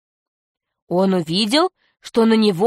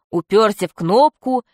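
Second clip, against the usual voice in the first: ordinary delivery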